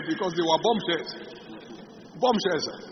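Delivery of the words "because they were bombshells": ordinary talk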